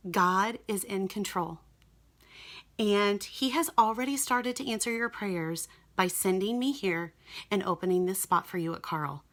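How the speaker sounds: softly retold reassurance, letting each phrase settle; calming nurse. Nurse reassuring a panicking patient about God, calmly. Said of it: "to calm me mostly".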